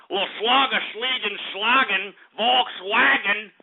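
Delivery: hammed-up fake German, last word slowed; mock German accent. Host mocking German viewers in a fake German accent. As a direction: spout German-sounding gibberish. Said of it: "that German accent sucked".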